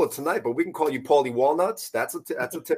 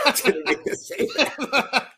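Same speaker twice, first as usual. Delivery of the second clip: laughing; 11 semitones higher, slower than usual. Real, not acted. Laughing after the slip.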